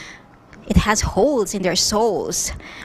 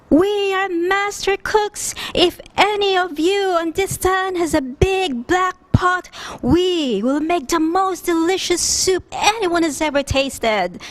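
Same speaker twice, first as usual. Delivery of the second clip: proud shout from the well; 9 semitones higher than usual. A narrator's reading. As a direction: announce it to the whole village like a showman.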